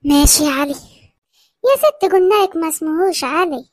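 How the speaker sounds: ordinary talk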